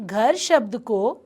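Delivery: ordinary delivery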